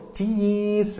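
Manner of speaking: "cheese" said high and slow through a smile; grinning photo "cheese"; smiling cheese. Shown as a demonstration. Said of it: "kalau kita difoto".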